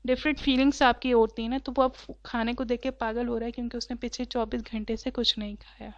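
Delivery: usual speaking voice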